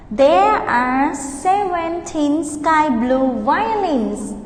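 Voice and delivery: slow, clear model sentence; slow model answer. Shown as a demonstration. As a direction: pace it for repeating. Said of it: "practice repeating after me".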